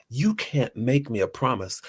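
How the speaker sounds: ordinary talk, nothing marked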